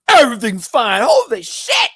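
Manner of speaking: loud, high comic outburst; grown man reveal. Retold or imitated. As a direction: shout it like a child turning out to be a grown man. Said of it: "it's like a grown-ass man".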